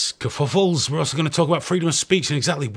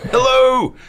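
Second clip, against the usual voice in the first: loud, high greeting shouted out; loud high hello; 11 semitones higher, 7 dB louder than usual. Shown as a demonstration. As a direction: belt out a big high hello. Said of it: "you gotta say hello to me".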